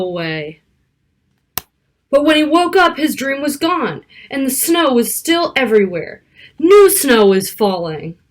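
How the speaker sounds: lively picture-book read-aloud; reading to children. Acted. Narrator reading a snowy storybook to young children, lively. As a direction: read it like storytime for little kids.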